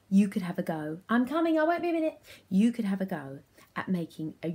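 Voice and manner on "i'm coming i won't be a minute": high-pitched call to another room. Pretend. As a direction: break off and call to the waiting children. Calls out to waiting children.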